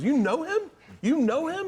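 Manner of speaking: high, loud disbelieving squeal; dad can't believe his son knows him; amazed dad. Retold or imitated. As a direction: shriek it in disbelief.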